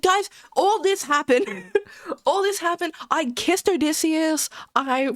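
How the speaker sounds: high, laughing pretend stream confession; giddy mock announcement. Streamer gushing to viewers next stream, giddy mock announcement. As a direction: squeal it through helpless laughter. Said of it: "I'm gonna be like".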